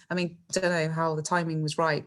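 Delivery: normal talking voice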